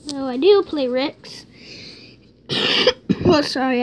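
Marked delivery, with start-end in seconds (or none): 2.5-3.2 deep rattling cough; real cough into the mic; chesty cough. Real, not acted. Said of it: "I have a cough".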